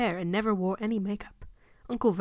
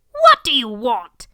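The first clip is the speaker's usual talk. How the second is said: suspicious aunt; 2 semitones higher, 11 dB louder, slower than usual. Acted.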